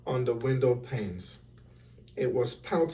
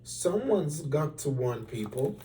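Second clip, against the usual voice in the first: ordinary delivery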